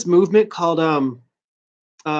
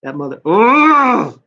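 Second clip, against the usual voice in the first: gleeful; 6 semitones higher, 3 dB louder than usual. Retold or imitated. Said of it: "'Cause I'm like".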